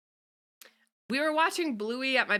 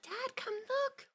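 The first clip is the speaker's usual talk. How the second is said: put-on high, soft, slow child voice; tiny kid calling out softly, drawn out; little cartoon kid; 8 dB quieter, slower than usual. Imitating cartoon child calling Dad over, little kid voice.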